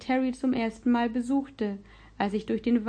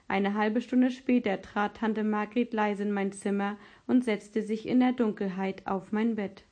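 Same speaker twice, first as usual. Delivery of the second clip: ordinary delivery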